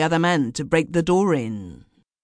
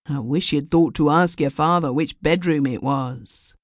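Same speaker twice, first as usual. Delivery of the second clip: usual speaking voice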